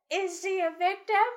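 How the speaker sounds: high ceremonial elder voice; town elder voice. Acted. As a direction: proclaim it like the old elder. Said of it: "The elder addresses the town".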